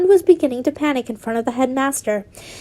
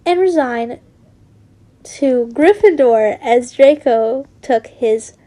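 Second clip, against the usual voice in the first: ordinary delivery, nothing marked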